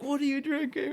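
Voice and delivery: imitating the Korean guy high and bright-eyed; a lightly mocking high voice for the stranger; high put-on voice. Korean businessman asking Stan at the bar, high mock voice.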